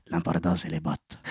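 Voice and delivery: put-on low, soft Spanish-accented swagger; hushed, deep Spanish-accented cartoon hero; Puss in Boots Spanish accent. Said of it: "c'est le chapeauté, hein, que j'entends".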